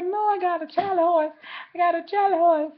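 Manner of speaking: high, strained voice in pain; an older lady groaning about a cramp. Retold or imitated. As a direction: godmother answering the child in a high, pained voice. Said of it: "And she's like".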